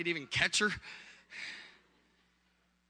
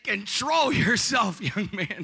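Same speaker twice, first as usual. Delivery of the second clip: retold scolding; 2 semitones higher, 5 dB louder, much slower than usual. Pastor to husband on phone, loud drawn-out scolding. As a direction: stretch it out like a stern telling-off.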